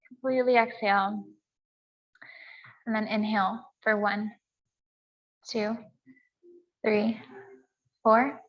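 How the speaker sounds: calm, measured yoga teacher's voice with long pauses; guided meditation. Real, not acted. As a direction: count the breaths evenly, leaving space between phrases. Leads breathing and meditation.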